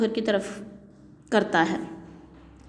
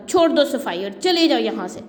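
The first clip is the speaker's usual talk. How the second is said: ordinary delivery, nothing marked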